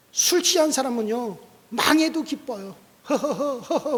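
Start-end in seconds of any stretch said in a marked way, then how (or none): 3.1-4.0 hearty mock heh-heh-heh; put-on tipsy chuckle; imitated drunken laugh. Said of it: "웃어요".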